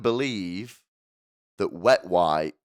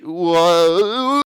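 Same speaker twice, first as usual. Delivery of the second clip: loud whoa; 10 semitones higher, 13 dB louder than usual. Real, not acted. Comic exclamation after a bit.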